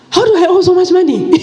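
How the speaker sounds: high, loud, fast cry of disbelief; can't believe the bill; shocked disbelief. Retold or imitated. Mother retelling the debt letter, shocked and high-pitched. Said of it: "And I'm like".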